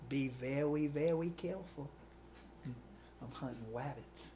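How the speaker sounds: lightly voiced cartoon hunter, soft and slow at the end; a hint of a hushed, creeping hunter; Elmer Fudd. Preacher imitating Elmer Fudd for the congregation. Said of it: "Anybody remember Elmer Fudd?".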